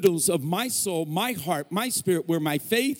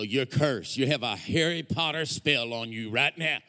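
mock ominous verdict; 5 semitones lower than usual. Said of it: "I go".

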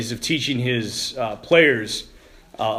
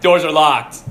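usual speaking voice

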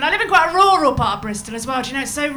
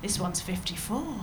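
lower, slow hushed gossip; 6 semitones lower, 11 dB quieter, slower than usual. Retold or imitated. Colleague quietly reading out a patient's age. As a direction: murmur it like office gossip.

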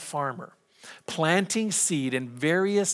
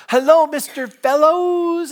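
put-on high, slow little boy voice; little boy greeting; 12 semitones higher, 7 dB louder, slower than usual. Pastor voicing a cheerful kindergartner, high and slow. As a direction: chirp it like a happy five-year-old.

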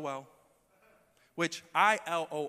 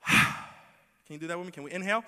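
guided breath; 2 semitones higher, 5 dB louder than usual. Shown as a demonstration. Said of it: "give us a brain break".

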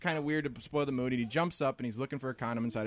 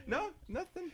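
ordinary talk

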